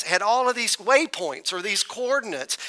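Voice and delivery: ordinary delivery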